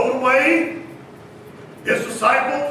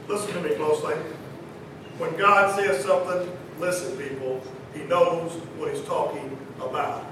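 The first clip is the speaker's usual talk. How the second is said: low and soft, confidential; hushed earnest appeal; 9 semitones lower, 8 dB quieter than usual. Real, not acted. Preacher asking people to listen closely, hushed earnest appeal. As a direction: drop the voice and press the point gently.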